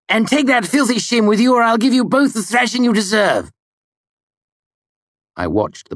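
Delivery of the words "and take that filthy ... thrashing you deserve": high, loud threatening yell; furious shout. A narrator's reading. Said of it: "red-faced and furious".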